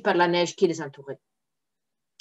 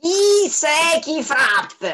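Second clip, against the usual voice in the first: slow, high rasping demon; hoarse nasty devil voice; 9 semitones higher, 4 dB louder, much slower than usual. Acted. Devil behind the rock asks who knocks, slow and rasping. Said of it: "une voix rauque et désagréable".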